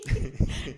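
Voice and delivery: breathy voiceless laugh; a gasping teary laugh; breathless laugh. Retold or imitated. In the garden retelling, a voiceless sob-laugh. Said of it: "I started crying".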